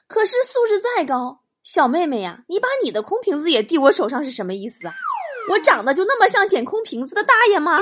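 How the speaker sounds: mock-outraged, high rant; indignant protest. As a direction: protest it hotly, rising to a loud finish. Indignant complaint to a girl. (又高又急地抱怨)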